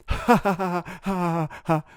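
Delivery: slow, hearty ha ha laugh. Real, not acted. Laughing at the teacher's silly abbreviation. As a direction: laugh it out slowly.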